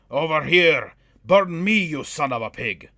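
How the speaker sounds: loud high taunting yell; defiant shout. A narrator's reading. Dwarf taunting the dragon away from the gate, shouting. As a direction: bellow a taunt at the beast. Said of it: "Ahira shouted".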